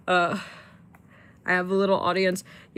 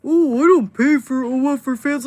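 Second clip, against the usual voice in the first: high, loud mocking voice of a cheap guy; mocking whiny guy; 8 semitones higher, 9 dB louder than usual. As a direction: sneer it out like a stingy fan. Mocking cheap guys' excuses.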